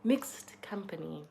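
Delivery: whispered "Mixed company.". Shown as a demonstration. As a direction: breathe "Mixed company." as a slow secret whisper. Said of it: "new word alert".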